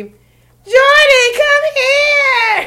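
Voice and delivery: high, loud, squealing call; loud high squeal calling her over; excited shout. Retold or imitated. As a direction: squeal her name across the lot. Imitating Ashley calling out excitedly.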